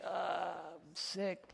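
very slow, faint fake-sick moan.

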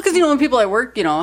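ordinary delivery

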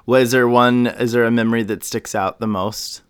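ordinary talk

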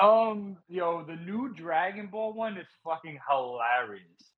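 ordinary talk, nothing marked

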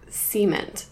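"cement" as SEE-ment, stressed up front; Southern "cement". Retold or imitated. Imitating boyfriend's word for concrete, "cement" as SEE-ment. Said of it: "don't pronounce it like that".

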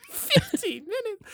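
incredulous laugh, pitch shooting up; laughing disbelief. Real, not acted. Disbelief at fifteen minute wait.

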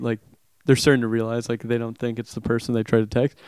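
ordinary talk, nothing marked

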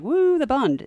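high-pitched pretend cheer for the group; mock cheer. Host mimicking imagined German American supporters, mock cheer. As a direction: imitate supporters cheering, half in jest. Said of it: "all the German Americans were like".

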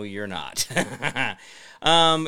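ordinary talk, nothing marked

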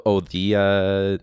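usual speaking voice

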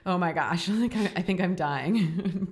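laughing mock panic. Retold panic during birth.

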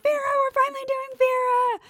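high, loud squeal of delight; thrilled squeal. Retold or imitated. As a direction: squeal it like a fan who finally gets her wish. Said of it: "I was like".

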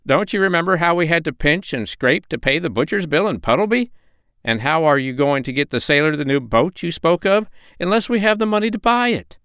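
high-pitched, nagging duck housekeeper; scolding duck. A narrator's reading. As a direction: reproach him in a high duck voice. Voices Dab-Dab scolding.

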